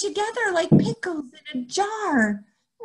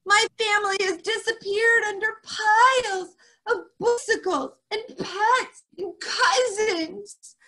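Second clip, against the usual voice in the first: pleading lament; 9 semitones higher than usual. Acted.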